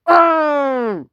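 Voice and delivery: long, high, loud falling "oh"; pretend outcry. Protesting in a play quarrel, high falling cry. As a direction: wail it loud and let it slide down.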